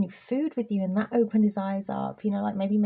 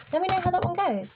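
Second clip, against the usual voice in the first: high playful; 9 semitones higher, faster than usual. Real, not acted.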